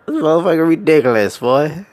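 usual speaking voice

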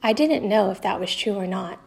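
ordinary delivery, nothing marked

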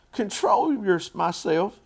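high, loud indignant yell; incredulous child's outburst. A narrator's reading. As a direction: throw it back as an outraged little girl. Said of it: "yelled Fern".

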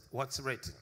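normal talking voice

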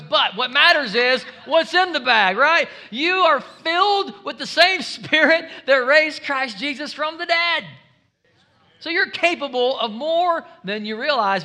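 loud, high shouted preaching; preaching at full force. Acted. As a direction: drive it home to the congregation.

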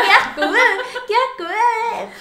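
high, loud mock disgust in a friend's voice. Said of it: "como diciendo". (asco exagerado imitando a Flor)

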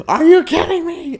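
very high, loud mock outrage; yelling. Furious kid at the wrong episode, yelling. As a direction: shriek it like a livid child. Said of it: "Why are you yelling?".

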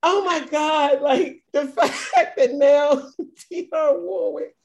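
can barely get the words out for laughing; real giggling fits breaking up the words; laughing hysterically. Real, not acted. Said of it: "I'm hysterical".

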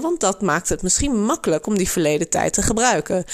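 usual speaking voice

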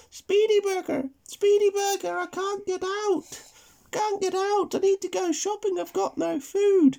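high, loud granny call across the road; old granny calling. Acted. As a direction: shout it out like a stranded granny.

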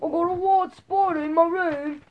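slow, put-on Australian accent; mock Australian accent. Son showing off to dad, mock Australian accent. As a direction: play an Australian talking, drawn out. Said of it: "That's Australian".

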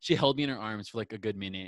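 ordinary talk

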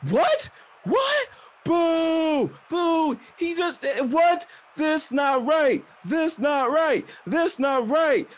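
high-pitched shouted boos and protests; outraged crowd. Acted. Crowd protests the wrestler's bad news, outraged shouting. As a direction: heckle like a whole angry audience. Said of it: "people are like".

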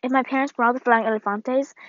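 ordinary delivery

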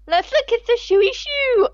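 "shooey shoo" said high and slow for shooting star; mispronounced. Shown as a demonstration. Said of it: "I couldn't say shooting star".

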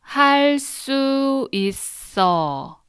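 할 수 있어 drawn out slowly, high and clear. Shown as a demonstration. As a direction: say 할 수 있어 slowly, syllable by syllable. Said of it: "Now slowly three times".

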